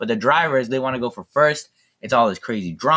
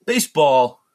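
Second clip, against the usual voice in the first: loud, punched movie-gangster line; Robert De Niro; 4 semitones higher, 5 dB louder, slower than usual. Retold or imitated. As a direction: imitate a mob boss's big pronouncement. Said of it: "It's Robert De Niro".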